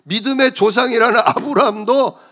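ordinary talk, nothing marked

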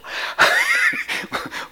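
real laugh at one's own words; amused laugh. Real, not acted. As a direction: break into an amused chuckle. Amused by own written message. (스스로 우스워 터진 웃음)

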